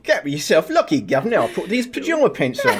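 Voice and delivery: mock Cockney, rising high into laughter; Cockney accent. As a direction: ham up a Cockney "governor". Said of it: "He's gone Cockney".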